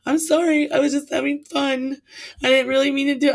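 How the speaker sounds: hammed-up teary sorry; mock crying plea. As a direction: imitate a tearful drunk girl pleading. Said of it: "here comes the girl crying".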